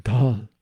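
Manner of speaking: "dal" with a heavy, exaggerated Indian d; Indian-style dal. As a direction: lean hard on an Indian "dal". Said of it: "I do it my American way".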